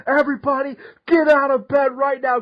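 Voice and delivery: high, loud re-enacted outburst; frazzled angry yell. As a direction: yell orders like a counselor at breaking point. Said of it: "how frazzled and how angry I was".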